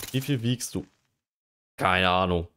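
usual speaking voice

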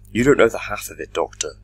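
loud, cutting in over him; forceful interruption. A narrator's reading. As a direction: cut in hard and loud. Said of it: "interrupted the minister".

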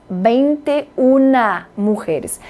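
"vinte e una" said slowly as "veintiuna"; slow model. Shown as a demonstration. Teacher models the feminine form slowly. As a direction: stretch out "una" before the feminine noun. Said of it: "E se fossem mulheres".

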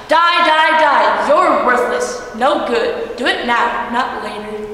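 drown her in sound; shrill, loud voices. A narrator's reading. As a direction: voices screaming at the fourteen-year-old girl to die. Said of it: "grew shriller and louder".

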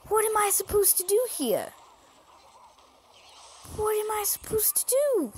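high, loud exasperated whine. Real, not acted. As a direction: plead with the viewers in frustration. Losing to higher level, exasperated.

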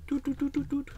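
high sung doots instead of whistling; mock whistle. Real, not acted. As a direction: sing a high doot doot. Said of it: "How do I whistle?".

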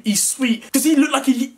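usual speaking voice

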